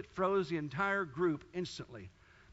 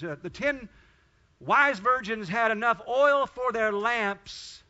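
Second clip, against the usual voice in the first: louder, higher sermon voice rising on the parable; preaching at full force; 3 semitones higher, 7 dB louder than usual. Acted. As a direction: drive the story home to the congregation.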